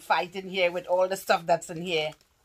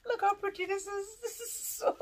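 much higher, airy squeal of delight; 5 dB quieter than usual. Real, not acted. Admiring a friend's gift, a high airy squeal. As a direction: coo it up high.

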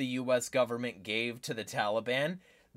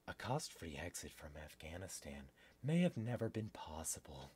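low, very soft reading voice; article read-aloud; 12 semitones lower, 16 dB quieter than usual. Real, not acted. Host reads news article to viewers, quiet read-aloud.

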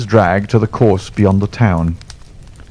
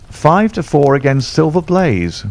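understated mock roar, pitched up; bookmakers' roar; 6 semitones higher than usual. A narrator's reading. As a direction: call out the betting like a ring crier. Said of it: "roared the ring".

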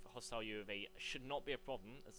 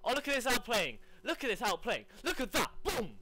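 loud, high triumphant shouting; overexcited; 8 semitones higher, 9 dB louder than usual. Real, not acted. Gamer celebrating his own kills, overexcited. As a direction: crow loudly over each successful play. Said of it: "So I got a bit too excited there".

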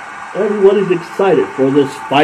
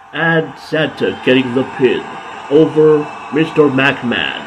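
normal talking voice

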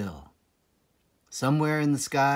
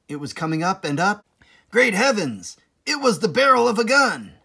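faster and louder into a cry of alarm; rising alarm; 8 semitones higher, 7 dB louder, faster than usual. A narrator's reading. Narrator spotting the gun, building to alarmed cry.

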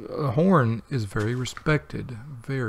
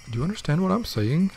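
imitating her; 6 semitones higher, 3 dB louder, faster than usual. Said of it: "She'd be like".

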